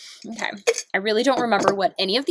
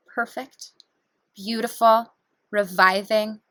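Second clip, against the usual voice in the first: guided meditation; 4 semitones lower than usual. Acted. Guiding viewers through breathing, in a calm meditation voice. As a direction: talk them gently through each breath.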